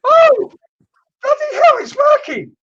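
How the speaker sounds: high, loud burst of delight; thrilled it finally works; real delighted shriek; excited. Real, not acted. Live reading finally connects, excited.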